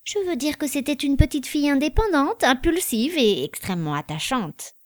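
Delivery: a hint of an old man recalling a girl fondly; speaking tenderly of a girl he once knew. Kittel answers fondly about the girl.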